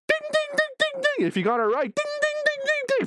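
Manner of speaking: high sung ding-ding like a game-show bell; mock quiz bell. Narrating the twist, rings a game-show bell. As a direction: ring it out like a correct-answer chime.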